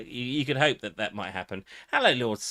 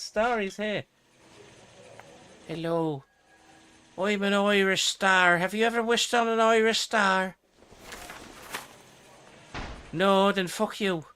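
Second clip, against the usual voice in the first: put-on Irish accent; 8 semitones higher than usual. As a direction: play a cheeky Irish star in a high Irish lilt. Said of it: "he gets all potty mouth in Irish".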